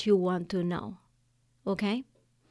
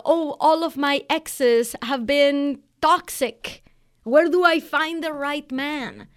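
louder, slower, fed-up complaint; hammed-up, louder dating complaint; mock exasperated client; 4 semitones higher, 5 dB louder, slower than usual. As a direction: vent like a client sick of bad exes. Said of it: "They're saying".

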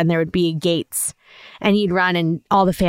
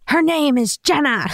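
indignant fan; 8 semitones higher than usual. Retold or imitated. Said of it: "one fan was like".

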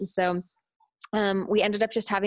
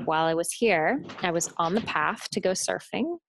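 plain reading voice

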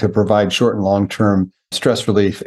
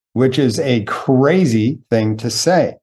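normal talking voice

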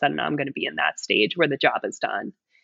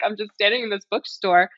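higher voice, lightly imitating the tearful mom; retold tearful mom; 5 semitones higher than usual. Retelling a client mom's bookstore breakdown, lightly tearful. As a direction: replay her upset words with a hint of her distress. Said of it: "I'm hysterically crying".

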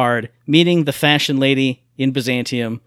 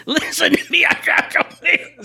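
laughing shout; 13 semitones higher, slower than usual. Real, not acted. Laughing rant about bad day.

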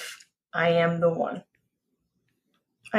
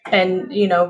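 usual speaking voice

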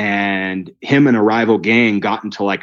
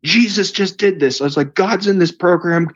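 much higher, gushing excitement; overflowing, can't contain it; beside himself; 9 semitones higher than usual. Retold or imitated. Telling the insurance reps about the miracle, gushing high. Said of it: "I don't even know what I'm saying".

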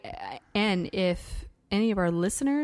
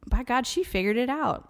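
ordinary delivery

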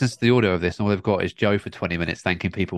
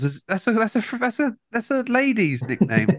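mock high voice; 12 semitones higher than usual. Teasing about the nickname Els.

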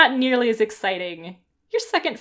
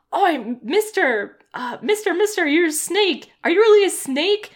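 put-on excited kid voice; 6 semitones higher, 4 dB louder than usual. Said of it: "they're like".

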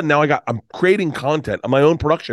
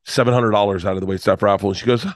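ordinary delivery

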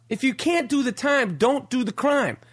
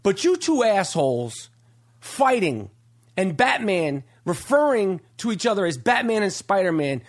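normal talking voice

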